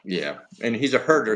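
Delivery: usual speaking voice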